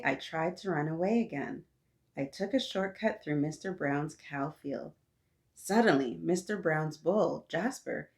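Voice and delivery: usual speaking voice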